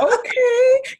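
high, delighted little "oh" of surprise; pleasantly surprised. Retold or imitated. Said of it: "I was like".

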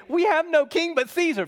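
loud, high preaching cry; ringing sermon shout; emphatic quote. Acted. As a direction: proclaim it to the congregation. Preacher quoting the crowd.

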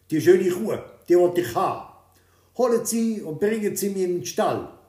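a greedy king orders his servants; commanding king. A narrator's reading. (lauter, befehlender König)

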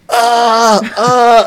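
hammed-up pain yelps; exaggerated mock scream. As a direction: overplay a slap that never landed. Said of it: "ich schreie".

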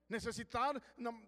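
ordinary talk

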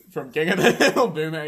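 high laughing explanation. Real, not acted. Explaining Boomhauer to a friend, laughing. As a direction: laugh through the name. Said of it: "laughing while explaining".

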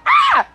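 short, high-pitched yelp of fright; startled scream. Retold or imitated. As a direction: scream as if caught off guard. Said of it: "and I screamed".

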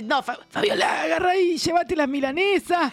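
lightly voiced complaining man; a hint of a whiny Alberto; Alberto's voice. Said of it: "que dice". (voz de Alberto quejoso)

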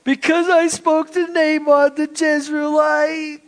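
high, whining, half-sobbing complaint; whiny crying Ahab. Acted. Ahab whining to his wife like a crybaby. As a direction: blubber it like a spoiled king. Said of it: "and you could just hear it".

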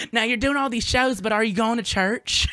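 high imitated church-going aunt; Aunt Angie. Aunt checking her nephew attends church, high imitated voice. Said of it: "she'll be like".

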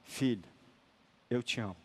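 a father's tender forgiveness, quiet; loving father. Retold or imitated. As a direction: say it gently like forgiving a guilty son. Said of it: "Ele vira e fala".

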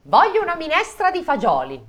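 high, loud yelled demand. Acted. The Sperelli yelling her order at dinner, high shout. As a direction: shout it like a spoiled diva. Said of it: "urlò la Sperelli".

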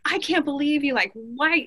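half-acted disbelief, scolding her body; mock exasperated. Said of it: "I was so mad at my body".

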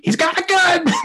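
very high, loud hammed-up scream; alarmed shriek. Airport security spotting a gun, mock panicked shriek. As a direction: screech a panicked warning to everyone.

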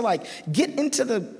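ordinary talk, nothing marked

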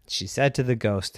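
usual speaking voice

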